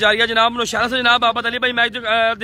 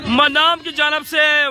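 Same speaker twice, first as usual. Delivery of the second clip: excited cricket commentary; 4 semitones higher, much slower than usual. Real, not acted. Live cricket shot call.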